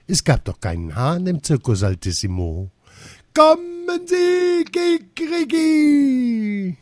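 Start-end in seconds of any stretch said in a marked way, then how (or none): 3.3-6.8 very high, loud, stretched-out crow; crowing cry. A narrator's reading. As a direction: crow it loudly, stretching every word. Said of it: "wer da so ein Geschrei machte".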